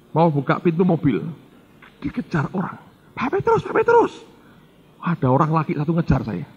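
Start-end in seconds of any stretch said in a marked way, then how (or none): 3.2-4.1 high calling after someone. Retold or imitated. A man running after the pastor, calling his name high. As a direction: call his name as you chase him.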